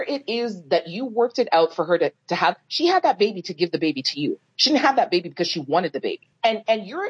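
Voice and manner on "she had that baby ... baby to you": normal talking voice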